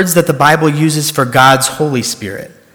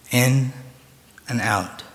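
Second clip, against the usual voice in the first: soft breathing cue trailing off; guided meditation; 9 dB quieter, slower than usual. Acted. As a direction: lead their breathing gently.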